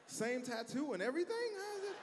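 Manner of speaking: incredulous disbelief. Retold or imitated. Comic to the crowd, high-pitched amazement. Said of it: "I'm like".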